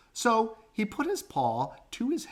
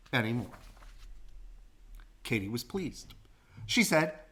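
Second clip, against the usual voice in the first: usual speaking voice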